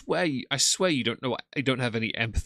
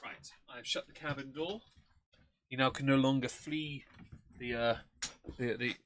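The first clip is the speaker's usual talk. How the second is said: ordinary talk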